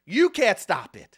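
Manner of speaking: usual speaking voice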